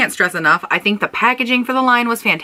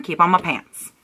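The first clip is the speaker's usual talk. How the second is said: ordinary delivery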